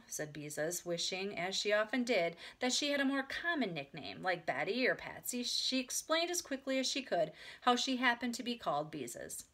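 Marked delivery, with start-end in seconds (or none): none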